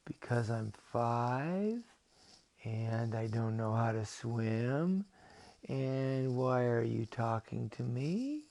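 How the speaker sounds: put-on very slow, drawn-out child's answer; hesitant five-year-old, word by word; nervous little boy. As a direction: stretch each phrase out, rising at the end. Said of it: "He says".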